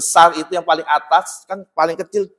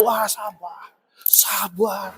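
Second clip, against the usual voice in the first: mild self-calming; 6 dB quieter, much slower than usual. Retold or imitated. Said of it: "Saya gini".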